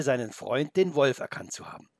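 plain reading voice

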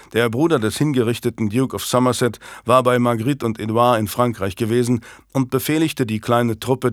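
ordinary delivery

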